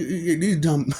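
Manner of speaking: ordinary delivery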